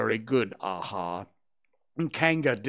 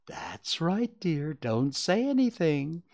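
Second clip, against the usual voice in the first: higher, lilting mother voice; motherly humouring; 6 semitones higher, slower than usual. A narrator's reading. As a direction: placidly approve as if praising a child. Mother humouring child in bath.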